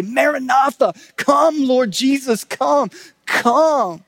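high, loud plea to heaven; pleading cry. Retold or imitated. Preacher voicing disciples calling for Jesus to return, pleading cry. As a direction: cry it out with urgent longing.

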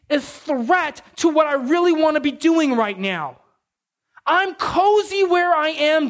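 whiny mock child. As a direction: ham up a pouting kid's grievances. Mock whining son monologue.

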